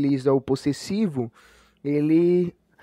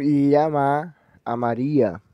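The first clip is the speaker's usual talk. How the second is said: llama slow, stressed on the final syllable, llaMÁ; 6 dB louder, much slower than usual. Shown as a demonstration. Teacher showing oxytone stress in the vos form. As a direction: hold and hit the last vowel of llama. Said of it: "ela é oxítona".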